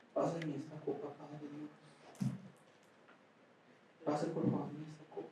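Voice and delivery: faint, near-silent "Pásame esta copa"; limp prayer. Shown as a demonstration. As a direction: murmur it limply, without any urgency. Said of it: "No, eso no está estresado". (oración floja, casi sin voz)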